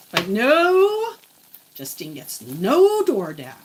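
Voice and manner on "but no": dramatic no. Pretend. Dramatic denial.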